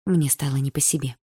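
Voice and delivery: plain reading voice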